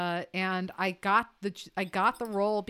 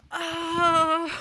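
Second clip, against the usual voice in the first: loud, higher, drawn-out "oh"; mock crowd gasp; 9 semitones higher, 7 dB louder than usual. Imitating the school crowd seeing the prince, mock shocked gasp. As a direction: gasp like a whole scandalised audience. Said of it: "the entire crowd goes".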